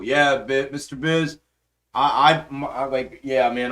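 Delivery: usual speaking voice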